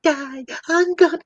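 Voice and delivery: high-pitched dramatic whine of doom; mock complaining. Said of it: "just complaining".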